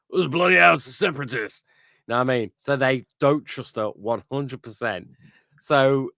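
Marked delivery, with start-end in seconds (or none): 0.1-1.6 louder, higher alarmed clone outburst. Retold or imitated. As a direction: belt it out like a startled soldier. Clones react to Ventress.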